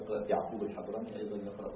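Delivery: usual speaking voice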